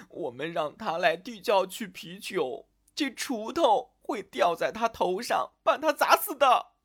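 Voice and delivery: high whimper into tearful wailing; crying while talking. A narrator's reading. Elsa explains to the maid through tears, crying. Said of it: "一边哭一边说".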